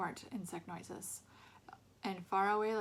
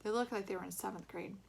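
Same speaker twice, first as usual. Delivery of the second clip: usual speaking voice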